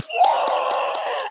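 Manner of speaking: imitated cheer from a crowd; drawn-out, held-back mock roar. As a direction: cheer like a whole film crew. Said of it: "everybody's like".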